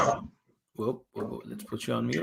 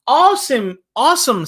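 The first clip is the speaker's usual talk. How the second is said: higher, louder cheer; excited; 10 semitones higher, 5 dB louder than usual. Acted. Teacher voicing Gavin cheering the garden work, excited and higher. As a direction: burst out with delight at the plan.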